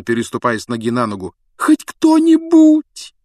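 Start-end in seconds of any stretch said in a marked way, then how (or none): 1.6-3.1 high, loud, slow wail; desperate plea. Acted. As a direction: cry out for anyone. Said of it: "отчаянно шептала Гермиона".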